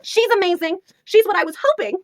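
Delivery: high, loud exclamations; excited gushing. Real, not acted. Reviewer praises the cover heroine, high excited gushing. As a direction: gush at full excitement.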